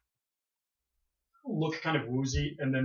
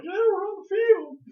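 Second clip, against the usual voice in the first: put-on high, loud, slow drunken cheer; rowdy fan; 9 dB louder, slower than usual. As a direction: whoop it like a fan storming the field. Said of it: "some people are like".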